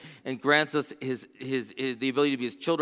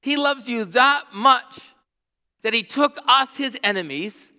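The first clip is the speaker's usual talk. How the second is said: louder, higher, slow preaching build; preaching at full force; 6 semitones higher, 4 dB louder than usual. Acted. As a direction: proclaim it to the whole church.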